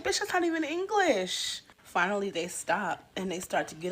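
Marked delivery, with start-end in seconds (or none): 0.0-1.6 high, loud, sassy indignant retort; indignant high squawk. Acted. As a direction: snap back in a high, put-on outraged voice. Indignant retort after gibberish.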